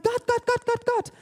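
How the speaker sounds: high, loud, pleading cries of Gott; desperate crying out. Retold or imitated. Believers crying out to God in their struggle, high and loud pleading. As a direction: call out to God like a screaming child. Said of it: "wir schreien und brüllen".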